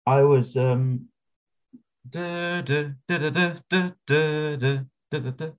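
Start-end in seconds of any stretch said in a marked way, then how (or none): none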